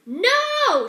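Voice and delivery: high, loud cry of No; a loud, high rat's shout. Acted. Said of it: "shouted Roscuro".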